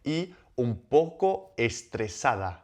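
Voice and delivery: slow model phrase. Shown as a demonstration. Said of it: "We're linking one part up".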